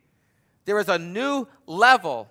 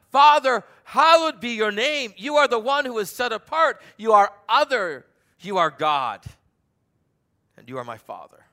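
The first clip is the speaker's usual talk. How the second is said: fervent prayer; 5 semitones higher, 7 dB louder than usual. Acted. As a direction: pray it out, then sink into intimacy. Praying the Lord's Prayer aloud.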